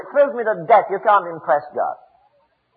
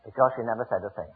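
hushed, low punchline after a long pause; hushed reveal; 13 semitones lower, 12 dB quieter, faster than usual. Real, not acted. Preacher revealing the answer to the congregation, hushed. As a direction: drop very low and soft, quickly.

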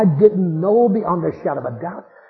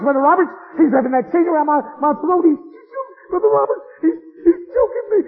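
dying man's rising panic, pitch climbing higher; terrified pleading; 6 semitones higher than usual. Retold or imitated. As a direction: beg for help as the devil closes in. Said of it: "screaming as loud as he could".

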